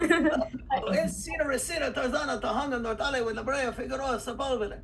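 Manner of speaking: high, rolling mock-Spanish patter; Spanish accent. Shown as a demonstration. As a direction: rattle off place names with a Spanish accent. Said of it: "you know, in Spanish".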